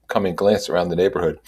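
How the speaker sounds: ordinary delivery, nothing marked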